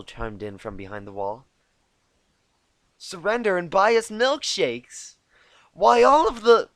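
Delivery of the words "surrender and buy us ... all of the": gleeful taunt. Acted. Pinkie joins the taunt.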